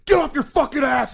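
high, loud drill-style yell; jarhead shout. Retold or imitated. Jarhead veteran ripping into lounging Air Force recruits, furious mock shout. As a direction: bellow it like an angry jarhead. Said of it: "bearded fucking asshole jarhead, I was just like".

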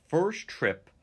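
high, loud, excited; excited emphasis. Real, not acted. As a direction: punch it out with excitement. Emphasising Zelensky's first trip.

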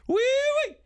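very high falsetto whoop; yodelled catchphrase. Shown as a demonstration. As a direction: flip way up into a yodel. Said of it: "That's like yodeling".

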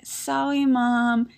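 put-on small child's voice, sorry said as "sowwy"; four-year-old boy. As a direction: apologize like a preschooler. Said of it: "And then Lenny's like".

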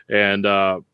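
usual speaking voice

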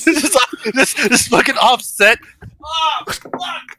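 high, laughing till nearly crying; cracking up; helpless laughter. Real, not acted. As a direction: choke the words out through laughter. Cracking up at imagined scene.

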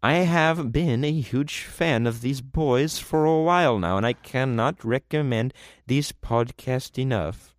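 lightly put-on French accent, slow. Host reading a fan review in a hint of French accent. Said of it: "That's a French accent".

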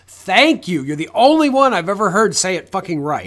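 loud, exasperated relief; emphatic gratitude. Acted. Podcaster as if thanking the actor, loud emphatic gratitude. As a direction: thank him loudly, punching the words.

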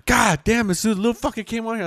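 imitating a friend's surprise; mock shock. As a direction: yelp it like the heat just hit. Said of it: "You're like".

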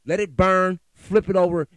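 angry shout. Real, not acted. Angry podcaster shouts about letting it burn. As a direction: shout it out in fury. Angry rant, burning imagery.

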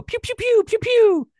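high, loud "pew" blaster noises; imitated ship lasers. Joking that the ship would go, imitated laser blasts. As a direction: fire off piercing falling pews. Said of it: "That ship would look at you and go".

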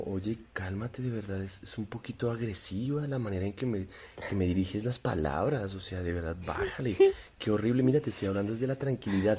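soft, condescending calm voice; mock soothing. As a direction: smugly play the calm one to provoke. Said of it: "es como".

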